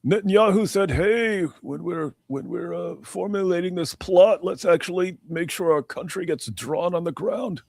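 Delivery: high, put-on silly voice; hammed-up, high-pitched parody; mock conspiracy theorist. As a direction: spin an absurd plot in a high voice. Ridiculing theory with invented quote.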